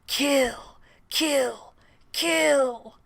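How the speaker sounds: a menacing chant breathed from the walls; creepy whisper. Acted. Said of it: "It's that creepy whisper you did".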